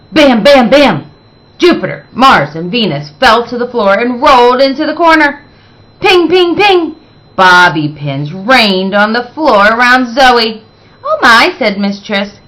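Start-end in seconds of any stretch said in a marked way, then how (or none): 0.1-1.1 punchy, louder bams; loud sound effect. A narrator's reading. Sound effects of falling balls. 6.0-7.0 much higher, bright pings; high pinging sound. A narrator's reading. Bobby pins falling sound. 11.0-11.5 high, louder startled gasp. Acted. Teacher startled by chaos.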